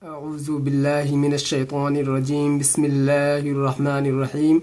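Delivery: slow, soft, low-key Quranic recitation; opening recitation. Real, not acted. Teacher opening the lesson for viewers, soft slow recitation. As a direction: recite softly and unhurriedly.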